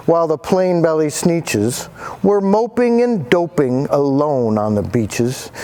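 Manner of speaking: ordinary delivery, nothing marked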